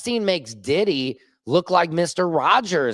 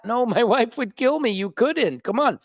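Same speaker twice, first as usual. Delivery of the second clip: higher, pleading voice with a laugh; mock pleading; 6 semitones higher than usual. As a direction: beg him not to release it. Acted pleading reply.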